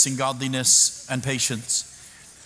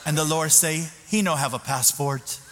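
put-on lightly accented, laughing retelling; mild Spanish accent, amused. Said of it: "I say".

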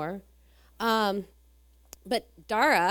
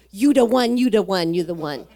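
loud repeated you the one, fading to normal; prophesy it loud, then let it die down; insistent proclaiming; 9 dB louder than usual. Retold or imitated. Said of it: "with a broken English".

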